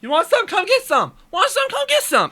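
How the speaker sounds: wild, very high mock challenge; high-pitched taunt. Boyfriend goading disgusted girlfriend, high mock taunt.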